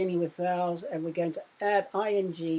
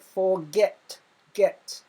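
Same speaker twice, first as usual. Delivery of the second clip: "forget" and then "get" alone, both punched louder; stress on GET; 5 dB louder, much slower than usual. Shown as a demonstration. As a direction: hit "get" in "forget", then say "get" by itself, loud. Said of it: "you can hear it".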